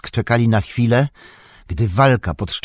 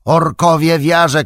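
high, loud alarm shout; 8 semitones higher, 6 dB louder than usual. A narrator's reading. Old Gamling warning of orcs in the gully, shouting. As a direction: cry the warning out across the fortress. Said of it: "krzyknął".

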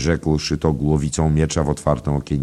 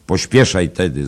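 higher, louder hurry-up call; called-out urging; 4 semitones higher, 6 dB louder than usual. A narrator's reading. Hungry traveller urging on the innkeeper, called-out urging. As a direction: hurry the host along. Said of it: "zawołał ów podróżny".

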